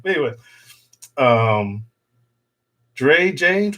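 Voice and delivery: normal talking voice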